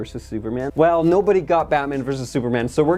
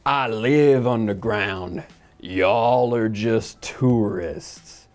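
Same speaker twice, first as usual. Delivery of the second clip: slow, lower growly-monster read; mock Killer Croc; 4 semitones lower, much slower than usual. Mocking Killer Croc's sewer line, slow low brute voice. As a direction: draw each word out like a lumbering brute. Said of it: "he goes".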